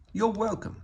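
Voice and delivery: high, friendly bird voice; cheerful high Big Bird reply. Acted. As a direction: lift it up into a big sunny bird. Big Bird answers Maria.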